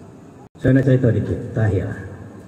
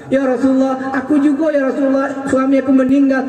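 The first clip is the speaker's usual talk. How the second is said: pleading widow; 9 semitones higher than usual. Acted.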